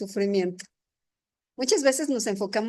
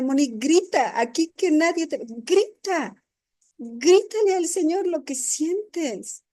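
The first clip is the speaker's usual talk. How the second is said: higher, insistent coaxing; urging friend; 6 semitones higher than usual. Retold or imitated. Friend on the hill urges Moni to shout, insistently higher. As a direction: push her to let it out.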